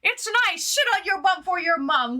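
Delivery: high-pitched, playful put-on voice; mimicking a clip. Streamer echoes "I like you" alert, high mimicking voice. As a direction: parrot it back in an exaggerated high voice. Mimics the soundboard clip's lines.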